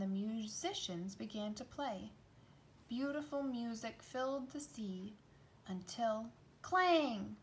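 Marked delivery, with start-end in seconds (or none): 5.7-7.4 drawn-out, punched clang; slow, heavy stress on clang; crashing clang. A narrator's reading. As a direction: land the clang like a cymbal crash. Cymbal crash in the story.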